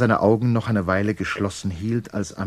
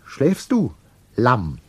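high-pitched, hesitant question; tentative, timid character voice; 6 semitones higher, 3 dB louder, slower than usual. A narrator's reading. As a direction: ask timidly in a high voice, pausing before the last word. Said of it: "mit zaghafter Stimme".

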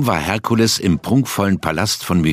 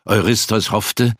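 ordinary delivery

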